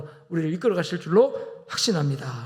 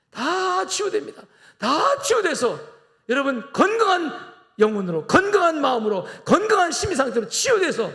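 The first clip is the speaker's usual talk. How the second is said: high, loud, ringing pulpit cry; impassioned sermon climax; fervent preaching; 9 semitones higher, 3 dB louder than usual. Acted. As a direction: lift the congregation with a rising preacher's call. Preaching at full force.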